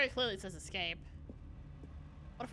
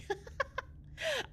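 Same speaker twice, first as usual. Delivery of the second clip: nervous laugh; 4 dB quieter than usual. Real, not acted. Nervous laugh after fright.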